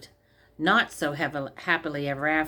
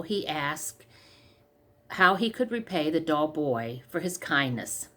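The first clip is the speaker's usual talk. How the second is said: plain reading voice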